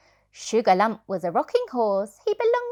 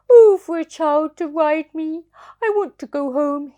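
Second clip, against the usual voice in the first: higher, louder pleading longing; homesick horse voice; 7 semitones higher, 9 dB louder than usual. Acted. Reader voicing homesick circus horse, pleading. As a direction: beg to go home. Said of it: "he said".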